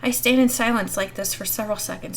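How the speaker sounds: ordinary delivery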